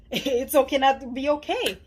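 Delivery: laughing through it. Retold or imitated. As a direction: laugh it off while saying it. Laughing reassurance to herself.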